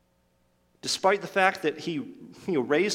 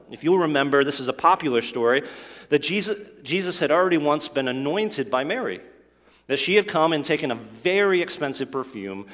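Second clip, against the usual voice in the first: usual speaking voice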